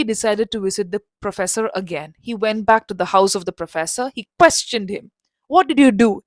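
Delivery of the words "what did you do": angry demand. Acted. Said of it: "He got so angry".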